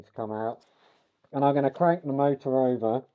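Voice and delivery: talking through a face mask; muffled voice. Real, not acted. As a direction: speak muffled behind a mask. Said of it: "sorry for the muffled voice".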